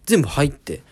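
ordinary delivery

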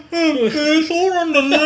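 high, loud, mumbled nodding along; mock a man who can't move his tongue; tongue-tied mumble. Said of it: "ずっと".

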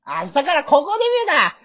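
high, loud declaration; fired-up debut hero. Shown as a demonstration. Redoing it to show his partner, fired-up hero. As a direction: announce your new self proudly. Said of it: "俺がもう一回やるわ".

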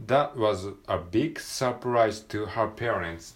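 slow, careful model reading; teacher modelling the sentence; slow read-aloud. Shown as a demonstration. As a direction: read each word out slowly for learners. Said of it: "単語をピックアップしていきましょう".

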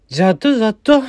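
ジャットジャット said crisply as a Kagoshima-dialect yes; ジャットジャット, a light Kagoshima accent; Kagoshima dialect word. Shown as a demonstration. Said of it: "鹿児島弁では、".

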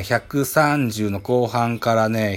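ordinary talk, nothing marked

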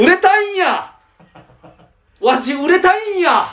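high, hammed-up Kansai whine; 売れたいんや in a high, overdone Kansai whine; Kansai dialect. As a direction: beg in an exaggerated Kansai voice. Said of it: "変なとこで関西弁出すな".

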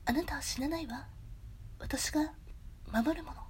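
soft, whispery, spaced-out heroine; attempted Hayashibara. Streamer as a heroine promising protection, soft whispery imitation. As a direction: hush it and pause between phrases. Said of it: "林原さんの声やっぱ出たら".

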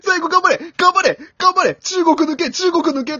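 loud, high, frantic cheering; cheering commentator. Retold or imitated. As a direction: scream the anchor runner on. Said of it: "とか言って". (必死に声援を送る解説者)

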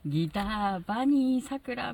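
high, slow drawn-out stage intro; Showa band intro. Retold or imitated. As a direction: introduce the guitarist like a retro band. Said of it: "みたいな". (昭和バンドのメンバー紹介)

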